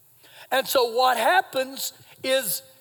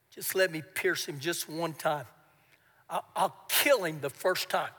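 low, much softer eager plea; hushed urging; 9 semitones lower, 9 dB quieter than usual. Retold or imitated. Soldier urging David beside the sleeping king, hushed. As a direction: press the request under your breath. Abishai urges quietly over sleeping Saul.

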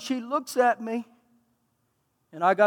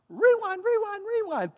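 much higher playful rewind cue; rewind call; 11 semitones higher, 4 dB quieter than usual. Acted. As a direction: sweep them back in time. Dramatic flashback cue.

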